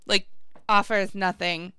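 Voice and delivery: ordinary delivery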